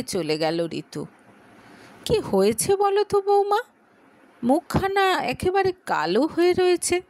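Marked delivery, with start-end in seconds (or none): 2.0-7.0 higher, a hint of an anxious older mother; worried mother. Mother asks daughter-in-law about Ritu's gloomy face, worried. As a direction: ask gently after the sulking girl. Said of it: "চিন্তিত মুখে বললেন ঋতুর মা রেখা।".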